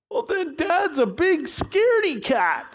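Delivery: put-on very high child voice; squeaky kid delivering punchline; little boy voice. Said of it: "that shaky little voice says".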